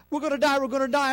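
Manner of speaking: quick, half-hearted imitation of screaming; imitated crowd panic, fast; mock panicked cry. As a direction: rattle off a mild mock panic. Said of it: "start screaming".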